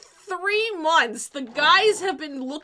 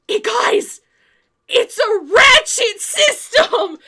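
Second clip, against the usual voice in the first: slow, much louder and higher shout, laughing; excited discovery; 7 semitones higher, 9 dB louder, much slower than usual. Retold or imitated. Dave announcing to the guys he figured it out, excited shout.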